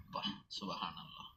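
whispered in awe. Real, not acted. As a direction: barely breathe the words, moved. Awed exclamation at child's request.

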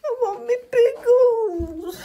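slow high whiny wail; childish whine. Acted. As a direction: whine like a toddler. Childlike whining for pickles.

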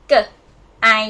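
"g", then "I", each short and alone; separate letter sounds. Shown as a demonstration. Teacher spelling out chicken, "g" and "I" sounded separately. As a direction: sound out "g" and "I" with a gap between. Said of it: "This is a g sound".

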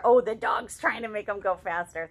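usual speaking voice

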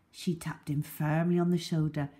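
ordinary delivery

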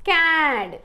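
"క్యాడ్" with long vowel, falling pitch; pronunciation demo. Shown as a demonstration. Teacher modelling how it is read, "క్యాడ్" drawn out. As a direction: stretch "క్యాడ్" as one blended word. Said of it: "అని పలుకుతుంది".

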